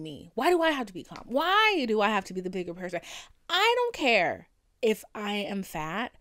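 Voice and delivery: loud, emphatic self-defence; indignant rant. Real, not acted. Defending her rage after being instigated, loud and indignant. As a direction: demand to know why, hitting each point hard.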